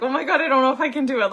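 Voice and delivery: high, loud, fast nervous rush; a scared wife rushing to husband; panicky. Retold or imitated. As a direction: blurt it out half-panicked. Admitting fear to husband.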